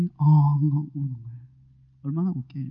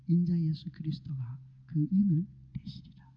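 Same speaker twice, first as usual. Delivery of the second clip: a hushed, reverent whisper; 3 semitones lower, 6 dB quieter than usual. Real, not acted. Preacher revealing the hidden one in a reverent whisper. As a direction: reveal it softly like a secret.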